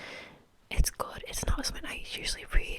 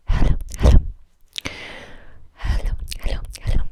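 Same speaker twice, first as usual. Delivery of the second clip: loud breathy whisper; 11 dB louder, faster than usual. Acted.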